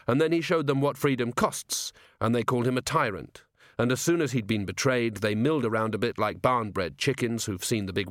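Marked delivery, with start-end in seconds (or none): none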